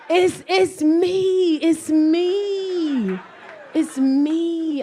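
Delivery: drawn-out, hammed-up self-blame; comic confession. Shown as a demonstration. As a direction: stretch out the admission dramatically.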